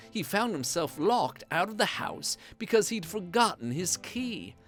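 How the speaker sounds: plain reading voice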